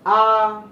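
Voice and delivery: loud, drawn-out आ held long; long vowel demo. Shown as a demonstration. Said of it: "ये है दीर्घ".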